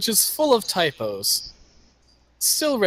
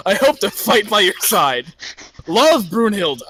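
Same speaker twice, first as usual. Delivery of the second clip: Brunhilde's voice; 3 semitones higher, 4 dB louder than usual. Acted. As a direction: read the letter as a cheery barbarian girl. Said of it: "do it in her character voice".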